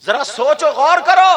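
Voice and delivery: high, loud exhortation rising to a shout; full-force preaching. Real, not acted. Preacher urging listeners to reflect, full-force preaching. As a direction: cry out the appeal, building in force.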